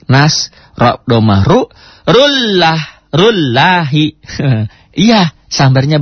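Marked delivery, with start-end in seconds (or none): 2.1-4.1 "rullah" high, "rullahi" slow; struck syllables. Shown as a demonstration. Teacher demonstrating the struck reading to students, high. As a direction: strike "rullah, rullahi" high then drawn out. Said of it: "Disamber aja".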